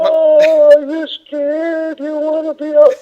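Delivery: very high, drawn-out cooing; mock baby talk. Mocking investigators addressing a five-year-old spirit, high slow baby talk. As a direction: stretch it out in a babyish squeal. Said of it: "talks to their five-year-old kid like that".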